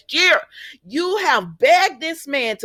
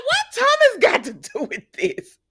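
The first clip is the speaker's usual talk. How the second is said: high-pitched, mock-baffled question; 13 semitones higher than usual. Recapper playing Brooke not getting it, baffled high voice. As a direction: imitate her bewilderment way up high.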